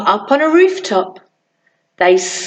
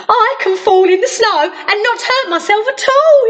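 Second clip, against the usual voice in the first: joyful cry; 9 semitones higher than usual. Acted. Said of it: "he cried".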